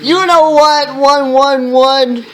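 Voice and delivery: high, loud, hammed-up indignation; mock angry rant. Author telling preps they do not care, mock angry.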